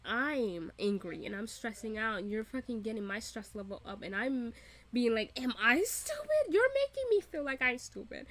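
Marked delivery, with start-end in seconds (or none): 5.4-8.3 high, indignant mock protest; worked-up, high-pitched disbelief; exasperated outburst. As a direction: act out being driven up the wall, voice shooting up. Acting out own exasperated reaction.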